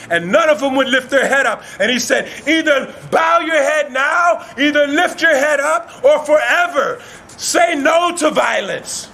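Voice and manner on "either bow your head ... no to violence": high, ringing command; shouted ultimatum. Acted. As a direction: lay down the law to a crowd. Beast gives crowd an ultimatum.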